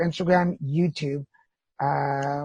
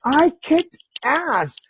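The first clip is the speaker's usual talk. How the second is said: triumphant boast; 9 semitones higher, 4 dB louder, much slower than usual. Acted. Triumphant quote at high pitch.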